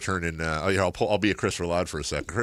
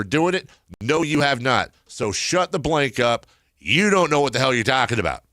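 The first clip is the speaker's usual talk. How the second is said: reading an angry tirade, higher pitched; quoted dressing-down; 5 semitones higher, 4 dB louder than usual. Retold or imitated. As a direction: act out a heated interrogation, building in pitch and force. Reads Kris's angry dressing-down of Toby.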